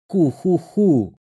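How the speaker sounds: медленный зловещий смешок ку-ху-ху; посмейся как злодейка; злодейский смешок. Acted. (злорадный смешок)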